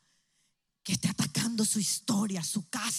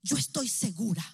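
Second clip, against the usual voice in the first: ordinary talk, nothing marked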